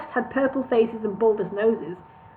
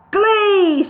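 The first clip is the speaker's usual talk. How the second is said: loud, much higher shout; 10 semitones higher, 7 dB louder than usual. A narrator's reading. Boss shouting for the glee, loud and high. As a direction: bark the word with a fist bang. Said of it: "shouted Al".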